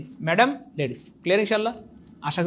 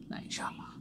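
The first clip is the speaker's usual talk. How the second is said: whispered aside, much softer; 20 dB quieter than usual. Acted. Teacher closes his reminder to students, whispered. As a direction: breathe it out like a secret.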